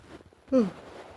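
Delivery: loud, high yawn. Real, not acted. Tired at almost two in the morning, big yawn. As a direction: let an unintended yawn escape. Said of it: "I didn't mean to yawn like that".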